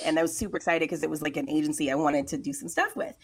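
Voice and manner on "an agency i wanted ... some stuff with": usual speaking voice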